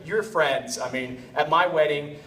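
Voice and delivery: ordinary talk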